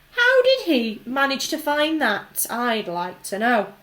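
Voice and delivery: loud, resentful shout; angry shout. A narrator's reading. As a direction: shout it out, jealous and cross. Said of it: "a large boy shouted angrily".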